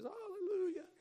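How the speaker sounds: high mock congregant hallelujah. Preacher mocking a congregation's hallelujah, high-pitched. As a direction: squeal it like an excited churchgoer. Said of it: "everybody says".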